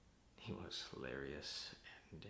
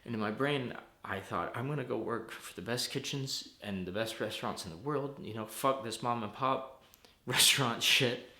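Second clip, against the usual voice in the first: loud, higher, driven rant; heated defiance; 5 semitones higher, 12 dB louder than usual. Acted. Vowing to outdo brother's restaurant, angry and loud.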